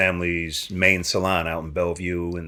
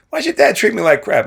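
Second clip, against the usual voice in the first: indignant high complaint; 14 semitones higher, 6 dB louder than usual. Retold or imitated. Retold complaint to Mickey.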